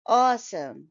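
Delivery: Awesome with a reduced schwa in the second syllable; strong AWE, weak neutral vowel after it in Awesome; schwa ending. Shown as a demonstration. Teacher models Awesome with schwa ending. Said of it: "there is a sh sound in the second syllable".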